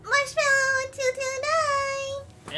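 high, slow drawn-out sign-off; sing-song sign-off. Acted. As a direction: announce the name playfully. Sign-off with channel name.

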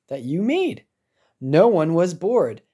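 ordinary delivery, nothing marked

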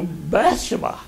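Bathsheba stressed on its first syllable; stress on BATH. Shown as a demonstration. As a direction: punch Bath in Bathsheba, unstressed "she". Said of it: "The emphasis is on Bath, not she".

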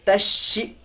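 तश शी with the sh pressed and doubled; firm doubled sh. Shown as a demonstration. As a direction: lean firmly on the joined sh in तश शी. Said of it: "थोड़ी सी सख्ती करते हैं". (तश शी, तशदीद पर थोड़ी सख्ती)

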